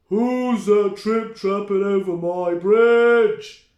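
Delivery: slow, loud troll roar. Acted. The troll challenges the big goat, slow loud roar. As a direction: roar it out, dragging the words. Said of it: "he roared".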